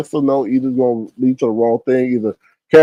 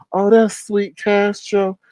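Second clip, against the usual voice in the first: mock sweet innocent; 5 semitones higher, slower than usual.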